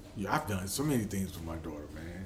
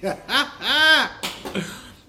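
high, loud burst of laughter; 14 semitones higher, 11 dB louder than usual. Real, not acted. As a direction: laugh out hard at the irony. Laughing at getting fired.

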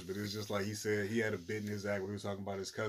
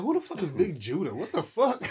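mock disbelief; 10 semitones higher, 7 dB louder than usual. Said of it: "I'm like".

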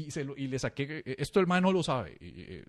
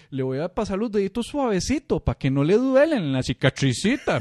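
sarcastic sweet barber voice; mocking tenderness; 5 semitones higher, 9 dB louder than usual. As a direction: tease him like a baby. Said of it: "Se empieza a burlar".

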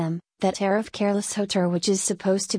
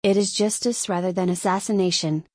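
ordinary delivery

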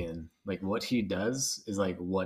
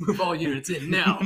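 barked order, higher and louder; mock agent command; 8 semitones higher, 4 dB louder than usual. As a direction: play a field commander calling in units. Playing the spy agents.

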